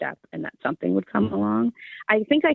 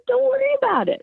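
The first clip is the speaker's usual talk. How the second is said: higher and louder, imitating a Southern dad; Southern dad brushing off the worry; light impression of a Southern father, laughing it off; dad's Southern accent; 5 dB louder than usual. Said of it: "he had this Southern accent".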